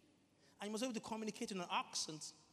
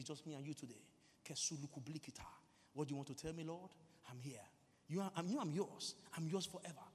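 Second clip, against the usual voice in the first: intimate prayer; 4 semitones lower than usual. Pretend. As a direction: talk privately to Jesus, just the two of you. Demonstrates private prayer in tongues.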